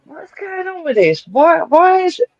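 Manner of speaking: high, slow baffled whine. Retold or imitated. To the group chat, a slow high baffled whine. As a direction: drag it out in high disbelief. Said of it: "I was like".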